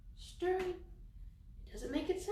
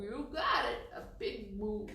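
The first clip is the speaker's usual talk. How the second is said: ordinary delivery, nothing marked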